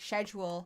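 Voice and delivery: "schedule" said the English way, starting with a sh sound; English pronunciation. Shown as a demonstration. Example of a word that kept its English accent, British "schedule". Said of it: "there are still words like".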